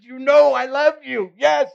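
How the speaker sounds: high, loud pleading insistence; anguished outburst. Acted. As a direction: break out, desperate to be believed. Peter's anguished third answer.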